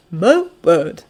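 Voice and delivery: put-on whispery, astonished old doctor; Doctor Tsubasa. A narrator's reading. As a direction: exclaim in a voiceless, amazed rasp. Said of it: "says Doctor Tsubasa".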